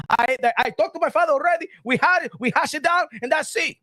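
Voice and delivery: high, hammed-up broken English with a Dominican accent; imitating a boxer getting heated, Dominican accent; mock Dominican accent. Vlogger imitating Matias dodging questions, mock Dominican accent. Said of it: "as you ask him about the Dominican side".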